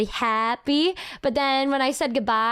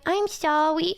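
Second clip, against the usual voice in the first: cutesy apology; 4 semitones higher, slower than usual. Retold or imitated. Imitates partner's cute apology.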